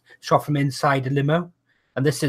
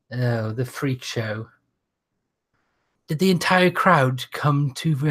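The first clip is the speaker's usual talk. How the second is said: ordinary talk, nothing marked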